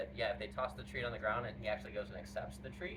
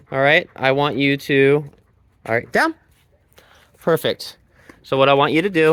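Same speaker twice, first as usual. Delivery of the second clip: ordinary talk, nothing marked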